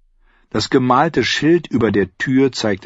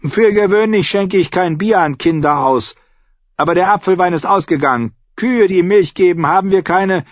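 high, forceful landlady voice; brusque innkeeper; 11 semitones higher, 5 dB louder than usual. Acted. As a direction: tell young guests what's on offer, high and bossy.

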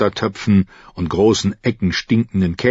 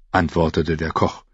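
usual speaking voice

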